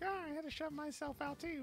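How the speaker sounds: squeaky imitation of a customer echoing back; high mocking customer reply. Customer voiced in the story.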